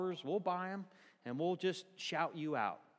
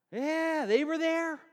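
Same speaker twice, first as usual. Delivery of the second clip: high, loud emphatic exclaim; rising up to praise the ladies; excited exclaim; 10 semitones higher, 6 dB louder, slower than usual. Real, not acted. Preacher praising the ladies, high and loud.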